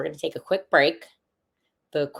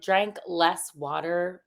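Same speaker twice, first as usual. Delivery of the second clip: ordinary delivery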